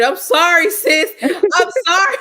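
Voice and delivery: high, loud mock-sorry; squealing sorry, sis; loud laughing apology. Real, not acted. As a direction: yell a playful apology.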